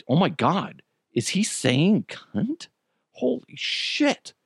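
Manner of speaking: slow, hushed mock disbelief; mock shock. Parent first hearing the son's word, acting out shocked disbelief. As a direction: draw it out, rising high on the last words.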